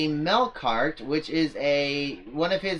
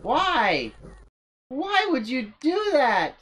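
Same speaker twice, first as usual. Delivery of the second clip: high, slowed disbelief; much higher, drawn-out baffled whine; incredulous; 10 semitones higher, slower than usual. Real, not acted. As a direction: ask yourself why in stunned exasperation. Baffled at his own past move.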